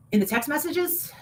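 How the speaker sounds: normal talking voice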